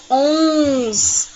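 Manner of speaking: ONS held long and nasal; drawn-out nasal ONS; talking through nose. Shown as a demonstration. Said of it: "parece que estamos falando pelo nariz".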